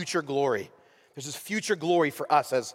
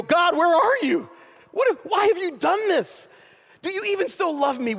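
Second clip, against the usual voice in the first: anguished plea; 13 semitones higher, 3 dB louder than usual. Pretend. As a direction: plead with God as if abandoned. Voicing a believer's lament.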